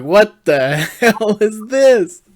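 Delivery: high, loud, drawn-out outrage with a laugh; slow it right down and cry out, appalled and amused; loud disbelief. Retold or imitated. Reacting to the changed facial hair, loud incredulous cry. Retells shock at cursed image.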